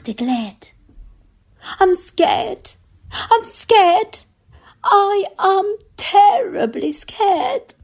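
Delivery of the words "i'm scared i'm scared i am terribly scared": high, frightened cook voice; nervous cook fretting to the king, voiced high and a bit louder; scared cook. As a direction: squeak out the cook's fear, pitched high. Cook repeats his fear.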